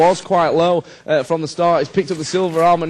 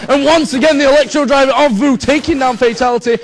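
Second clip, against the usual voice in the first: excited shout; 7 semitones higher, 5 dB louder than usual. Real, not acted. Another kill called.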